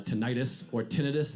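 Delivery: "tinnitus" first as tuh-NYE-tus, then as TIN-uh-tus; two pronunciations. Shown as a demonstration. Comic pausing over how to say "tinnitus". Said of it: "I don't know how you say it".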